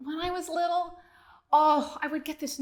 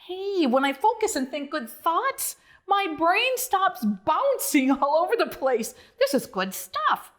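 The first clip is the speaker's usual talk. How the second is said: high, lively frog voice; a bright little frog discovering something; Bonzo's voice; 5 semitones higher, 4 dB louder than usual. Acted. Bonzo telling Duke, in a high frog voice. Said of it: "Bonzo closed his eyes and said".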